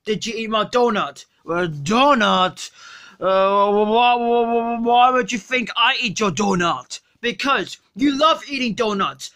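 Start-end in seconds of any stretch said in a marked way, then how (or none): 1.5-7.0 put-on sluggish, drunk and lazy; Homer Simpson.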